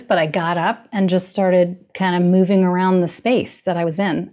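usual speaking voice

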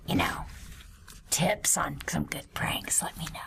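conspiratorial whisper. Acted.

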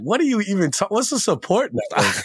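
as if the idea is completely foreign; baffled disbelief. Retold or imitated. He looks at her baffled.

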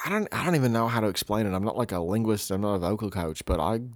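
ordinary talk, nothing marked

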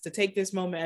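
ordinary talk, nothing marked

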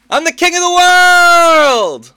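high, slow, booming yell; triumphant shout. Acted. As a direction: scream it from the ship's bow. Titanic quote yelled in character.

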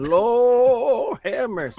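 high, slow, drawn-out Lord have mercy; appreciative. Real, not acted. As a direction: savour every word admiringly. Admiring a woman's looks.

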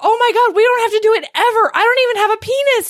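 high, loud, rushed reassurance; hurried, squeaky-high retraction; mock frantic backpedalling. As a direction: babble it in a comic panic. Acts out boy's frantic backpedalling.

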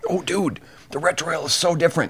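high, fast excited gush; giddy cigar-smoker's rush; real excitement. Real, not acted. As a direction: burst out with delight. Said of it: "I got a little excited".

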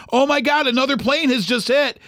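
panicked exclamation at talking volume; retold hysterics. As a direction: imitate someone frantic with shock. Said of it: "screaming at the top of her lungs and crying".

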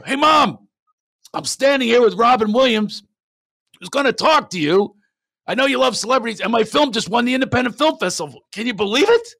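high, loud, gushing phone call to mom; retold call, bubbling over loudly; excited son.

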